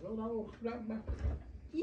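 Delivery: ordinary delivery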